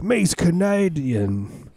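slow, high, hammed-up Canadian accent; Canadian accent done as a joke; mock Canadian accent. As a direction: drawl it out like a bad Canadian impression. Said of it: "doing a Canadian impersonation".

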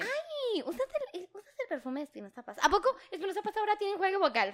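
high, fake-innocent girlfriend voice, quick at the end; mock sweet question. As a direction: tease him as if sweetly curious about his cologne. Mock-asking boyfriend about his perfume.

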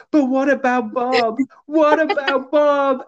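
high, drawn-out mock cry; over-the-top imitation of a pleading wife; loud retold wail. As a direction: wail it out loudly, dragging each word. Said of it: "she says it loudly".

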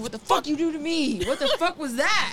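high-pitched, indignant retold demand; mock outrage. Woman confronting lover after orgasm, high mock outrage.

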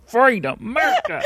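hammed-up patriotic cheer; mock patriotic. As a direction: ham up the patriotic pride.

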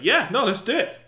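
high, loud, gung-ho reply; eager Gendry. Acted. Playing Gendry agreeing to the mission, high and eager. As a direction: jump at it, voice leaping up. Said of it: "when he was like".

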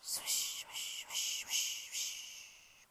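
whispered swish of brushes; soft whispered swishing; sweeping sound. Acted. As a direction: brush the words out in a hiss.

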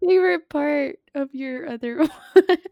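talking through laughter; laughing. Real, not acted. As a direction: giggle the words out. Laughing at gross sounds.

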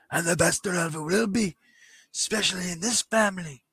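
badly faked English accent promo; hammed-up, halting English-accented wrestling boast; British Bulldog. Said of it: "I can't do an English accent to save my life".

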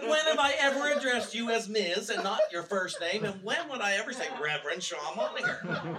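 high and loud, still laughing; laughing, amazed protest; incredulous retort. Retold or imitated. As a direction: pile on the disbelief with each question. Retold incredulous reply continues.

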